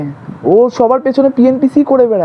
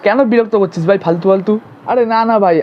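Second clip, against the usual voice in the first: normal talking voice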